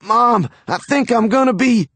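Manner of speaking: high, queasy, cut off; about to throw up; nauseous. A narrator's reading. Son about to be sick.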